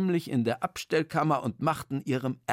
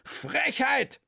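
much higher outraged exclamation; indignant cry; 11 semitones higher than usual. Acted. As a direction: spit out the outrage. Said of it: "schrie Herr Schweng".

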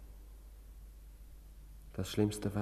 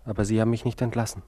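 loud, fast accusation; angry; 8 dB louder, faster than usual. Acted. Patient accusing his doctor, loud and fast. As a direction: snap it back quickly.

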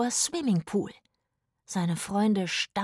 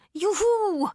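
high, drawn-out juhu; joyful whoop; 12 semitones higher than usual. Acted. Rufus splashing in the pool, high joyful whoop. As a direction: cheer it out gleefully.

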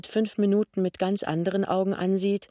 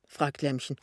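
usual speaking voice

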